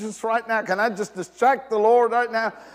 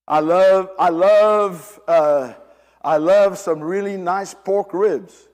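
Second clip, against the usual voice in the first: gushing love; 8 dB louder, slower than usual. Pretend. Preacher mock-gushing about loving ribs.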